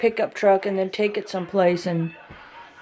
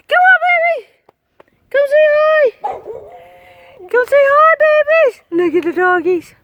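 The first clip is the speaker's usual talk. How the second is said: much higher, cooing voice for the dogs; doggy talk; 6 dB louder than usual. Acted. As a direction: coo at the dogs like babies. Cooing at the animals.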